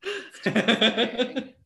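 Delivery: laughing disgust. Real, not acted.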